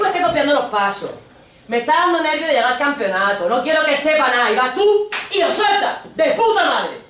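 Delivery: loud, high, scolding tirade; acted furious telling-off; angry sarcastic rant. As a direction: shout the sarcasm, building to a final burst. Acted boyfriend shouting at her.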